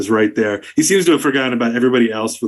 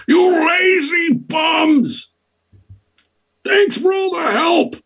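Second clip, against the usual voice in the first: furious yell; much slower than usual. Acted. Duke yelling out the window at lazy bullywugs, sarcastic shout. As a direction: bellow out the window at the loafers. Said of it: "he yells out at".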